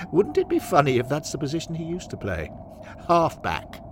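tickled by your own pun; amused club father. Acted. Henry jokes about the halved player, amused club father.

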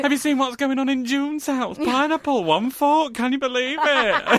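high, scandalised gossip voice; gossipy neighbour. Pretend. A neighbour spreading June's pineapple news, high scandalised gossip.

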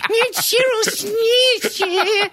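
very high slow squealing voice; cartoon pig voice. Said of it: "See, if I do it, it goes into the pigs from, um, the raccoons".

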